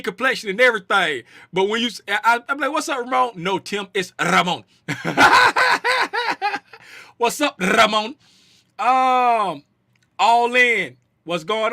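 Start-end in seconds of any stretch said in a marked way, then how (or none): 3.4-4.6 correcting, with Ramon starting on a trilled R; rolled R. Retold or imitated. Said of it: "he said his name is Ramon". 7.6-8.2 Ramon with a long trilled R; rolled R. Retold or imitated. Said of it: "he said his name is Ramon".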